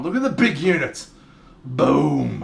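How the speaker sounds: booming, excited admiration; big hype shout showing off a mate; loud hyping. Real, not acted. Hyping an old photo on stream.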